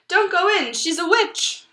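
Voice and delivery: high, loud urgent warning; loud little chicken warning. Acted. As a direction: shout a warning to the children. Chicken warns in story.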